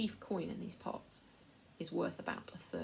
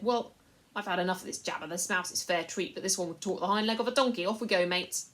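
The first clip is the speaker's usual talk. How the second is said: coarse slaver; 8 dB louder than usual. A narrator's reading. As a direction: talk down to the boy like a crook. Slaver ends talk.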